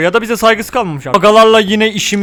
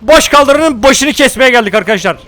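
mock battle slogan; 4 semitones higher, 4 dB louder than usual. Mock war slogan declaimed.

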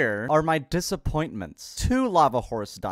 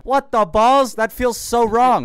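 high, loud disbelief; loud, high, shocked protest; shocked outburst; 9 semitones higher, 12 dB louder, slower than usual. Real, not acted. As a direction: yelp it in horror at the idea. Reluctant to throw item in lava.

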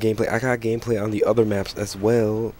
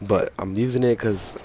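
ordinary talk, nothing marked

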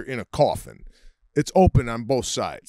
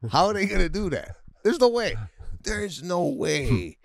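incredulous; 7 semitones higher than usual. Real, not acted. Disbelief about rescue.

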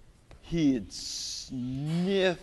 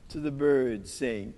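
ordinary delivery, nothing marked

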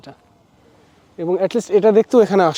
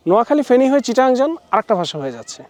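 ordinary talk, nothing marked

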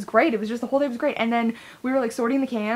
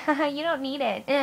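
lightly mimicked, higher mom voice; mock a dismissive mom, pitched up; mocking mom impression; 4 semitones higher than usual. Mom belittling her kid's tab collecting, lightly mocking. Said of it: "my mom's usually like".

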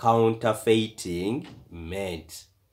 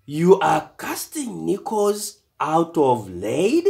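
surprised disbelief; 7 semitones higher, 4 dB louder than usual. A narrator's reading. Dad's shocked question to boys.

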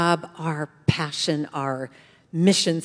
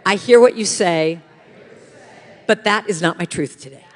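loud, firm lead for the room to repeat; defiant, louder affirmation; with attitude; 8 dB louder than usual. Shown as a demonstration. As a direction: say it boldly so they echo you. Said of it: "Repeat after me".

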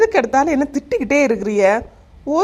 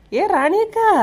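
much higher, drawn-out second call; 11 semitones higher, slower than usual. Acted. Young woman calls the neighbour again, high and drawn out. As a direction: call again, really pitching it up. Calling at the door.

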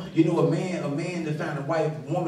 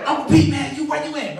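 raised, higher-pitched pleading voice, louder on "Uncle Pete"; calling Uncle Pete; 11 semitones higher, 6 dB louder than usual. Retold or imitated. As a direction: beg your uncle for bill money.

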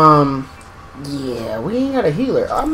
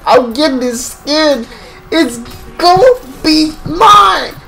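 wailing want; 12 semitones higher, 4 dB louder, slower than usual. Real, not acted. Craving new character skin.

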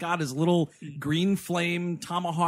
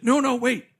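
higher, louder mock objection; mock protest; 7 semitones higher, 6 dB louder than usual. Retelling the movie hero fending off a seductress, mock-urgent protest. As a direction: cut in with a pretend urgent protest.

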